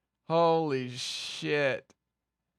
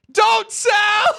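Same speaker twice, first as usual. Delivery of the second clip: high, loud laughing yell of don't sell; mock shout; 10 dB louder than usual. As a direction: shriek it like a panicked chat message. Reading chat, laughing and shouting.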